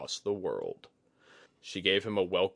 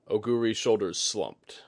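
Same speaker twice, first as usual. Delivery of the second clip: usual speaking voice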